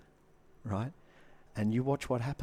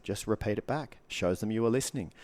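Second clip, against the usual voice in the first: normal talking voice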